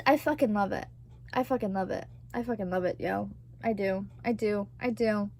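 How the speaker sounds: low, quiet repeated avowal; soft insistence. Real, not acted. Confessing love for the movie, low and soft. Insisting softly on loving the film.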